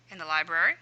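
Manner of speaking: usual speaking voice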